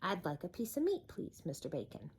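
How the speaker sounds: soft, polite request; quietly. Acted. Mister Noisy asks the butcher politely, quiet. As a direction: ask gently and politely. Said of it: "he said quietly".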